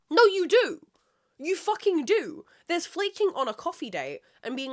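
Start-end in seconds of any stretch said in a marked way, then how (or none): 0.1-2.4 forceful. Real, not acted. Retort to imagined excuse.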